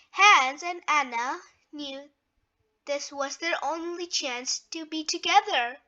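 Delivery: usual speaking voice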